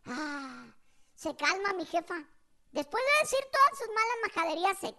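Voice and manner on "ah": soft, breathy sigh of relief; relieved sigh. Retold or imitated. Mother calming down after cursing, relieved sigh. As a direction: imitate Mom sighing contentedly. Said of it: "ah, un suspiro".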